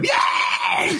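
very high squealed cheer; happy little gremlin. Retold or imitated. Little Phil praised by Mom for his scores, happy gremlin squeal. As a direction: imitate a tiny kid's overjoyed shriek. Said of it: "little gremlin with a happy face".

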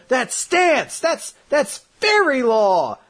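high, drawn-out mock astonishment; shocked onlookers. Everyone recognising the deadly spell, high mock shock. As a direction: exclaim it like stunned onlookers.

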